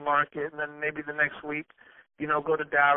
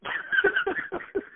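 laugh. Real, not acted. Laughing at canary suit.

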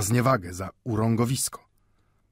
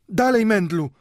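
high, loud cry from the doorway; urgent shout; 12 semitones higher, 7 dB louder than usual. A narrator's reading. Caretaker woman calls to Mendel from the doorway, urgent shout. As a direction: shout high to hurry someone. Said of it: "krzyknęła od progu stróżka".

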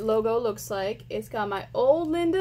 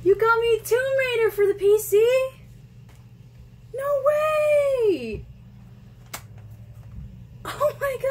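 excited shout; 10 semitones higher, slower than usual. Real, not acted. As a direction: scream with real delight at the gift. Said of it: "I'm just so excited".

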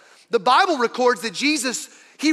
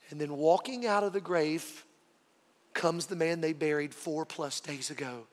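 usual speaking voice

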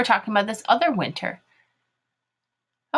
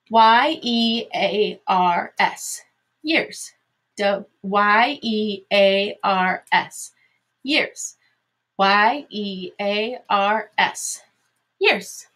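very slow letters, then the word pitched up; slow spelling drill; much slower than usual. Shown as a demonstration. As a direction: classroom spelling chant. Teacher spells words aloud three times.

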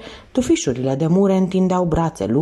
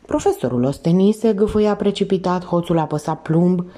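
ordinary delivery, nothing marked

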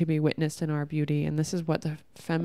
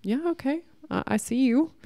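breezy brush-off; 8 semitones higher than usual. Retold or imitated. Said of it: "or you can be like".

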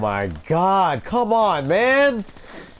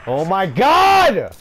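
high, loud, drawn-out scream; 7 semitones higher, 7 dB louder, much slower than usual. Real, not acted. Gamer screams at his screen, loud and drawn out. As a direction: let it rip at the screen, slow and stretched. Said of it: "I'm sorry for screaming".

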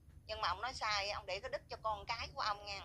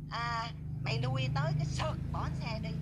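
louder, odd put-on voice quoting a man; quoting Quý; 7 dB louder than usual. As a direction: throw his rude words back at her. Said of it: "Nó nói là". (nhại giọng thằng Quý, to tiếng)

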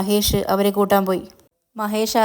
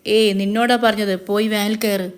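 usual speaking voice

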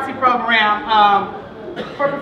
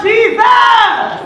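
loud, high, long-held cry; preacher's yell; 11 semitones higher, 8 dB louder than usual. Acted. Poet voicing papa crying out in church, full yell. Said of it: "He yelled".

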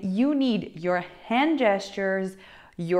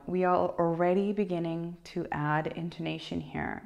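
ordinary talk, nothing marked

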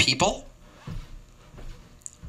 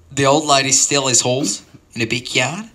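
ordinary talk, nothing marked

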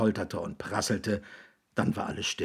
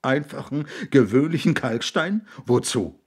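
loud, pitched-up hysterical disbelief; 5 semitones higher, 5 dB louder than usual. A narrator's reading. William questions the need for stone, hysterical. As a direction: fling out each question, near hysteria. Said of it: "in Wellen hysterischen Gelächters".

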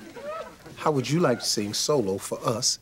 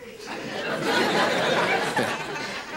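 high laugh. Acted. Joking about threatening him.

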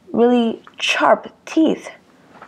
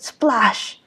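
ordinary delivery, nothing marked

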